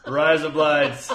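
loud, slow Australian accent through giggles; attempted Australian accent. Shown as a demonstration. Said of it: "trying to say it in an Australian accent".

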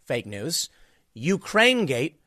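normal talking voice

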